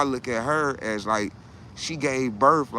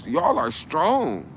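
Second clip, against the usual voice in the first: slow, louder, every word weighted; slow emphatic; 4 semitones higher, 4 dB louder, much slower than usual. Real, not acted. Telling women viewers they're strong, slow and emphatic. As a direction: spell it out slowly.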